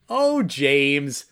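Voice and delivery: ordinary talk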